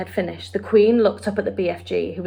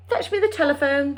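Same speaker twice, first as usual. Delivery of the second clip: a royal order, a touch higher; firm queenly command; Queen ordering the phone brought; 6 semitones higher than usual. A narrator's reading. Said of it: "the Queen commanded".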